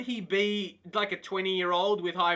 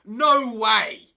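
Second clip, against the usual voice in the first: a loud stunned "No way"; loud disbelief; 3 semitones higher, 9 dB louder than usual. Real, not acted. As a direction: shout it in disbelief. Shocked by release clause.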